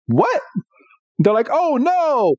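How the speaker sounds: very high, startled yelp; shocked squeal. Acted. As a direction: jump up in panic at the sight. Team Rocket shocked.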